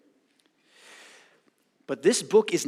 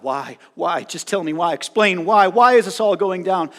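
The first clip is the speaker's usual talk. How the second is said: building from plain to a loud, higher demand; crying out; 4 semitones higher, 9 dB louder than usual. Retold or imitated. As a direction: press harder on each why until it's a shout. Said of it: "Job has been crying out".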